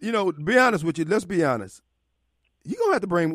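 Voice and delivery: normal talking voice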